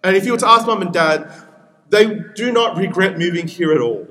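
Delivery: usual speaking voice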